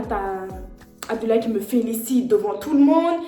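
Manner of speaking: ordinary talk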